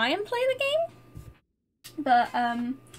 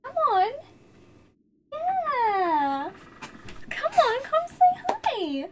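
high sing-song coaxing; cat-calling voice; 12 semitones higher, 4 dB louder, slower than usual. Real, not acted. Streamer coaxing her cat Mavis in a high pet voice.

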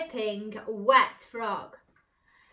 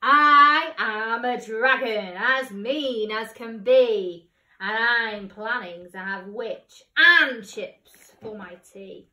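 mean dragon; 7 dB louder than usual. Acted. Dragon threatens the witch.

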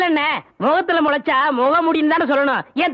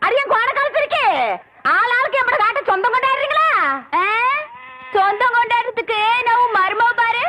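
high-pitched indignant tirade; angry scolding; 4 semitones higher than usual. Acted. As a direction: berate them with the pitch pushed up. Woman scolds everyone in quarrel. (உயர்ந்த குரலில் ஆவேசத் திட்டு)

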